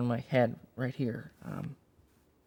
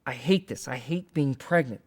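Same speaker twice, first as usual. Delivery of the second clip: louder despairing outburst; 6 dB louder than usual. Retold or imitated. Mother crying, voiced in session.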